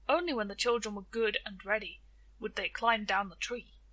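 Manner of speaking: ordinary delivery, nothing marked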